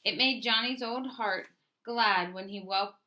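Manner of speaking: ordinary delivery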